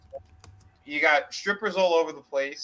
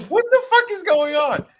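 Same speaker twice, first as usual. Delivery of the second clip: much higher, louder shocked shout; mock outraged yell; 13 semitones higher, 7 dB louder than usual. Retelling, the wrestlers burst into the room and yell in shock. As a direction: yell it like you just burst in. Wrestlers find wrong man in bed.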